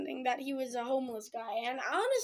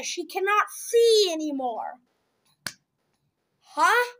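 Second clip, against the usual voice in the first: mock disbelief; 3 semitones higher, 6 dB louder than usual. Real, not acted. Mocking the plot twist.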